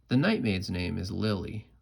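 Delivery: plain reading voice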